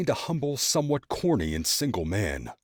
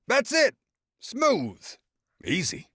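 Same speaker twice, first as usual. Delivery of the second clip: very high, pleased smooth easy; smug dad; 4 dB louder, slower than usual. A narrator's reading. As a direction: sell it like it's nothing. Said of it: "great father".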